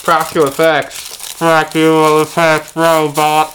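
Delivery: slow, loud, high mechanical monotone; robot buzzing through tin foil. Shown as a demonstration. Trying the foil trick as a new game character, robot voice. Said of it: "you've got a robot character".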